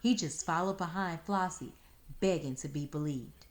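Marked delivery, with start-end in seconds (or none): none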